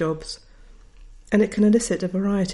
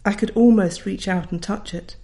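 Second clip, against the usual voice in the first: usual speaking voice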